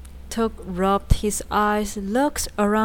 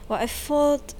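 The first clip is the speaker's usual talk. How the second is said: hesitant; 6 semitones higher than usual. A narrator's reading.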